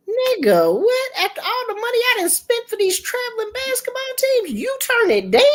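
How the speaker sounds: very high, fast, laughing outrage; outraged disbelief. Retold or imitated. Mother reacting to son's refusal, very high laughing outrage.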